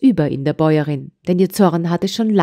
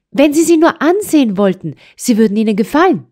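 put-on high, hurried child's plea; pleading girl; 7 semitones higher, 4 dB louder than usual. A narrator's reading. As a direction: coax her quickly like a hopeful child. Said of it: "meinte das Trini". (flehendes Mädchen, hoch und schnell)

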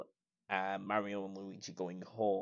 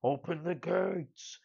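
higher, drawn-out villain's command; big slow put-on villain voice; Bowser; 7 semitones higher, 4 dB louder, slower than usual. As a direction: call it out slowly like a conquering monster. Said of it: "Bowser steps out of his castle and he's like".

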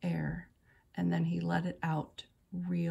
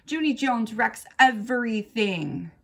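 loud, grouchy tattling; indignant accusation; 4 semitones higher, 8 dB louder than usual. A narrator's reading. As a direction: complain loudly to the teacher, pitched up. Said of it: "she grouched".

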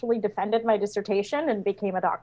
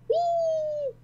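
high playful squeal; mock whee; 6 dB quieter than usual. Voicing the flying character, high playful squeal. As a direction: squeal like riding a ride.

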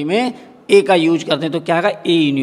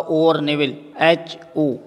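usual speaking voice

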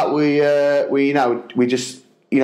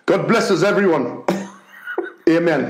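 earnest little Dickensian blessing; Tiny Tim-like blessing; 3 semitones higher than usual. Retold or imitated. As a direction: deliver a tiny improvised prayer in short pieces. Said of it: "Tiny Tim bit".

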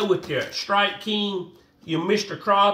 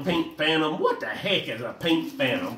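usual speaking voice

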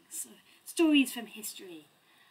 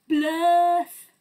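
loud, high "Blurth"; silly nonsense word; 5 semitones higher, 9 dB louder than usual. Acted. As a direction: belt out "Blurth" absurdly. Reading silly book word.

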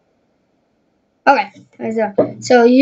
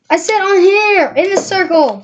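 a big sister correcting her brother, exasperated; exasperated correction; 7 semitones higher than usual. Real, not acted. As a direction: set him straight, half laughing in frustration.

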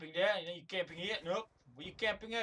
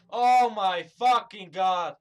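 loud, slow, drawn-out curse; exasperated rage; 10 dB louder, slower than usual. Real, not acted. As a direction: stretch each word out in loud exasperation. Player exasperated by the game.

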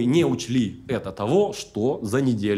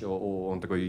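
ordinary talk

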